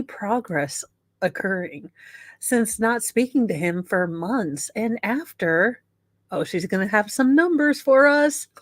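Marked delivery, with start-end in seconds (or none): none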